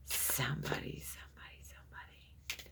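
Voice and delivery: hushed whisper as a presence arrives; a secretive whisper trailing into silence. Real, not acted. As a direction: breathe the words, barely voiced. Sensing a spirit arriving.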